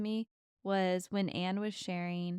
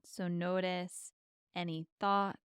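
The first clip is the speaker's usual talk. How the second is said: calm and unhurried, leave long pauses. Acted. Leads a guided meditation.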